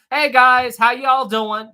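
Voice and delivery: high, loud Southern greeting; Southern accent. Retold or imitated. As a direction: greet them loudly in a friendly Southern twang. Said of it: "Sometimes with my accent, I will say".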